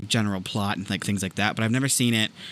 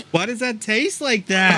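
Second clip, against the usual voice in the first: eating sour candy; 9 semitones higher, slower than usual. Real, not acted. Gross jelly bean reaction.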